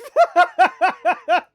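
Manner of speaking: loud high burst of laughter; real shrieking laugh. Real, not acted. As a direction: crack up loudly. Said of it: "I'm transmashed".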